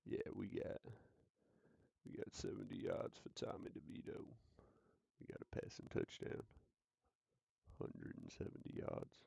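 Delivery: hushed, breathy mobster phone call; a sly agent whispering bets to the mob. Acted. Agent whispering to the mob about yards, hushed. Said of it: "He's like".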